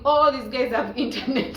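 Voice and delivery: laughing through the words; laughing at her own joke about internet. Real, not acted. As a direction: giggle as you say it. Laughing while quoting thought.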